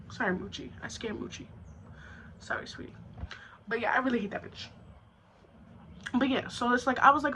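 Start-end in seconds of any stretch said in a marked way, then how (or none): 0.1-2.9 hushed, soothing aside; much softer, apologising to a startled little one; soft apology. Real, not acted. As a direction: quietly say sorry to a sweetie.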